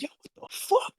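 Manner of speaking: high, unvoiced gasp of disbelief; whisper-shout it as if stunned; hushed mock shock. Shocked reaction to the slapping.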